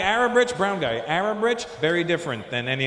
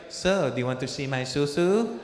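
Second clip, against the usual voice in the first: slow, lightly put-on come-on; mock flirtatious woman. Woman he met offering a peek, mock flirty. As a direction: tease out a slow invitation.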